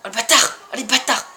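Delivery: excited shout. Real, not acted. Excited gamer cursing the race.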